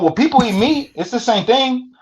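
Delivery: high, far-off mock voice of the Salvadoran inmate in broken English; imitating his accent. Retelling a cellmate's words to the host, high mock accent. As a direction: pitch it up high and foreign, like the little homie. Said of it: "that's how he talk".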